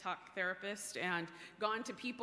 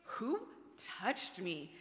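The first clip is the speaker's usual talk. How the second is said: diva moment; slower than usual. Retold or imitated. Preacher replaying Jesus's line to the congregation, sassy diva. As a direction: play the affronted diva with a dramatic pause. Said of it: "is such a diva moment".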